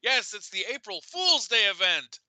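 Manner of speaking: high, loud triumphant reveal; spring the prank gleefully; gleeful gotcha. Retold or imitated. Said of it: "I'm like".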